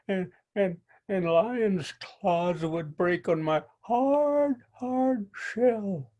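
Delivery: high, small, stuttering turtle voice; a timid little turtle trying to sound brave; stammering little turtle. Acted. Little turtle stammers at Coyote, high and shaky.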